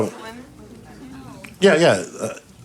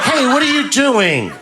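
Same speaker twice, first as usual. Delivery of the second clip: much higher, slow startled protest; woman wakes in the night and protests, startled; 12 semitones higher, slower than usual. Retold or imitated. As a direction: catch him in the act. Woman wakes to shirt removed.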